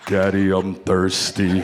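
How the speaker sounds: a lightly voiced horror-movie girl in the dark; creepy Ring girl. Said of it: "the girl in the Ring".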